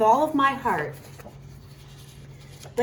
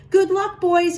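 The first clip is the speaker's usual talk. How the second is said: raised, higher voice calling goodbye; cried out; 7 semitones higher, 4 dB louder than usual. A narrator's reading. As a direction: call out after them, higher and louder. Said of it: "he cried".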